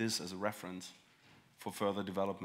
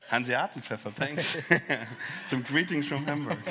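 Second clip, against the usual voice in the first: laughing, pleased and a bit higher; delighted opening a present; 5 semitones higher, 7 dB louder than usual. Real, not acted. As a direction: chuckle through happy thanks. Unwrapping a gift on stage.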